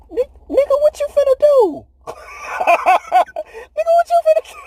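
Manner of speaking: high, incredulous mock voice of the disciples; hammed-up high disbelieving disciples; disciples in disbelief. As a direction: squeak out the disbelief like a stunned friend. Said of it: "them disciples was looking like".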